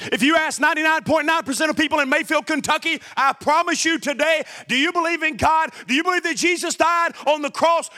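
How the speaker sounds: high, driving preacher voice; fervent preaching. Acted. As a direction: preach it hard and fast to the crowd. Preaching full force.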